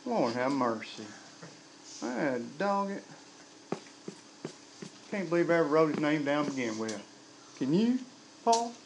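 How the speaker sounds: softly exasperated mock God; quiet, folksy heavenly dismay; comic voice of God. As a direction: grumble to yourself as a disappointed God. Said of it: "God is up there going".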